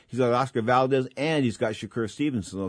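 ordinary talk, nothing marked

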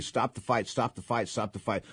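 ordinary talk

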